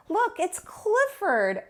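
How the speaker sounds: Emily spotting Clifford in the pumpkin, delighted call; excited call. Acted. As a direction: point and call out happily. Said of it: "called Emily Elizabeth".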